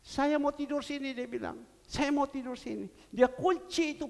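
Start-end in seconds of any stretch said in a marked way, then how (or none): 0.0-1.1 put-on high, pleading child's insistence; insistent child. Child refusing to go home. 1.9-2.9 put-on high, pleading child's insistence; insistent child. Child refusing to go home.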